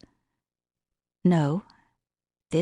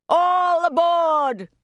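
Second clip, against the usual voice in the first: very high, loud, slow drawn-out station call; conductor's call; 11 semitones higher, 4 dB louder, much slower than usual. Acted. The conductor calls the passengers aboard, loud and drawn out. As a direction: sing it out like a train conductor.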